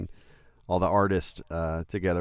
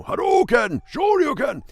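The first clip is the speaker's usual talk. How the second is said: much higher, louder Hadouken and Shoryuken yells; fighting game move shouts; 7 dB louder than usual. Shown as a demonstration. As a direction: belt out the signature moves like Ryu. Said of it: "I love, you know".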